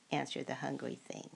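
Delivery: ordinary delivery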